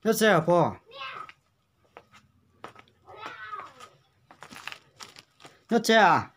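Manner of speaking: loud and high, calling to a deaf elder; to someone hard of hearing. Real, not acted. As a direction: raise your voice so grandma hears. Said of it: "phải nói to to bà cụ mới nghe được".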